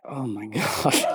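very low, slow, soft retold aside; quiet dread. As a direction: sink into a drawn-out murmur of dismay. Retold dread as cops approach.

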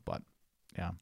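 ordinary talk

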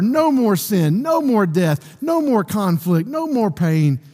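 high-pitched, insistent preaching cadence; fervent preaching. Real, not acted. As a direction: press the promise home, phrase after phrase. Preaches with fervor after the reading.

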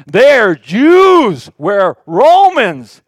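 sarcastic crowd-stirring shout; mock divisive rant. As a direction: hammer home us versus them. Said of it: "I'm being sarcastic".

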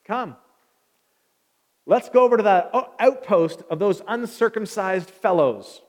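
loud, declaiming warrior voice; Jonathan's talk. Acted. Jonathan rallying his armor bearer to the outpost, loud and declaiming. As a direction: proclaim it loudly and deliberately. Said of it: "I don't normally talk like that, but Jonathan talks like that".

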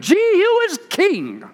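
high, slow ringing proclamation; slow lifted royal acclaim; proclaiming call. Retold or imitated. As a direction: call it out like a herald to the crowd. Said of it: "and proclaimed".

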